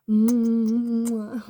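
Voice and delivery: loud affectionate hum mimicking grandma; mock loud kissy hum. Grandma smothering her grandson, loud affectionate hum. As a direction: hum into his face fondly and loudly. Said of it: "and then she'll go".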